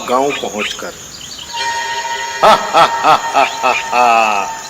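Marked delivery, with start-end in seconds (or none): 2.4-4.6 villain's booming evil laugh; evil cackle. Acted. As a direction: cackle like a gloating demon. Demon arrives laughing evilly.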